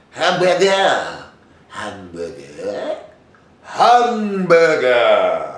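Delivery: hammed-up movie accent, stretched very slow; comic movie accent, drawing out each syllable; Pink Panther. Actor doing a Pink Panther monologue, hammed-up accent. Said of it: "You can do monologues from the Pink Panther".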